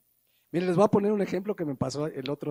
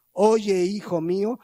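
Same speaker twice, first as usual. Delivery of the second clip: Oye much louder, then hijo mío; forceful scripture; 6 dB louder, slower than usual. Acted. Preacher repeating the verse to the youth, a loud proclamation. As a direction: proclaim the verse's opening word.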